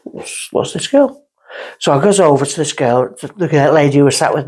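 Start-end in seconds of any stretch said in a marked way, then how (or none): 0.0-1.2 put-on drunk wondering. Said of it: "I'm sat there going".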